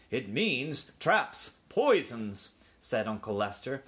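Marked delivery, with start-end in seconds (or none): 0.1-2.5 slow, weighty list of dangers; ominous. A narrator's reading. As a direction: space out each dreadful word. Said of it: "said Aunt Dorothy ominously".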